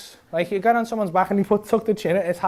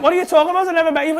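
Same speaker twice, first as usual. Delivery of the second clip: high, raised-voice protest; indignant girlfriend; 8 semitones higher, 5 dB louder, much faster than usual. Retold or imitated. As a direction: imitate a baffled woman protesting. Acting out the bewildered girlfriend.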